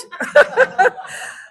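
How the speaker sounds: high, loud laugh; burst of laughter. Real, not acted. Storyteller amused by her eight-year-old self, high loud laughter. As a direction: laugh hard after your own punchline.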